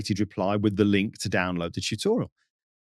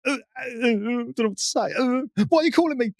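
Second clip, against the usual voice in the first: high, stammering shocked voice; lost for words, pitch jumping up; a caught-out man stuttering on the phone; flustered stammer; 12 semitones higher, faster than usual. Retold or imitated. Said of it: "The guy was like".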